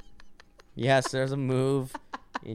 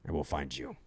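low, hushed mock menace; deep-voiced threat; 8 semitones lower, 10 dB quieter than usual. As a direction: promise quietly and deeply to hunt them down. Deep threat, scaring the listener.

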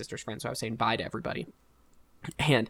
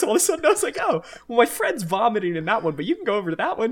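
laughing through words; 10 semitones higher, 7 dB louder, faster than usual. Real, not acted. Relieved answer while laughing.

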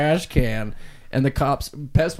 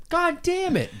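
high-pitched exasperated driver. Retold or imitated. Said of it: "He was like".

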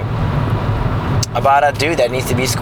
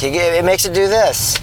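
mock condescending husband, a bit raised; 6 semitones higher than usual. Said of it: "the tone of his voice solidified that he thought she was an idiot".

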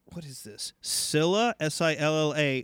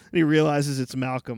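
ordinary talk, nothing marked

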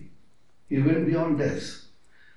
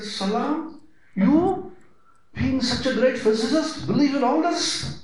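incredulous colleagues; 7 semitones higher than usual. Retold or imitated. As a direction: sound amazed that a physicist prays. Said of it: "all the other physicists said".

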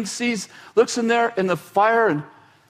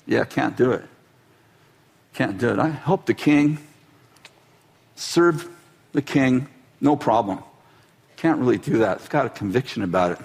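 much lower, steady, unbothered refusal; calm stubborn Mordecai, voiced much lower; Mordecai refusing; 10 semitones lower than usual. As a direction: drop low and shrug it off.

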